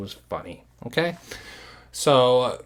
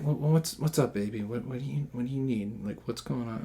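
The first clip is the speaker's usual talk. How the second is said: much softer gentle comforting; soothing; 10 dB quieter than usual. Retold or imitated. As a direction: hush her tenderly. Soothing drunk girlfriend.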